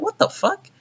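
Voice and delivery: can't believe what he just heard; high, baffled what the fuck; incredulous outburst. Real, not acted. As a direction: pitch shoots way up in disbelief. Exasperated disbelief at bad dialogue.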